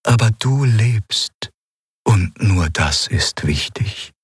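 slow, tender sorrow; sad. A narrator's reading. Eidolon consoles grieving Kai, speaking slowly and sadly. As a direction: draw each phrase out, gently mournful. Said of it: "antwortete es traurig".

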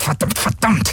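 high, rising repeated curse; a panicked pilot's outburst, rising; exploding curse. Acted. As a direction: let the curse burst out high. Galen cursing in panic.

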